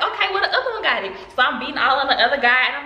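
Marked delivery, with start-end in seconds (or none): 0.0-1.1 excited. Retold or imitated. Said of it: "I'm like".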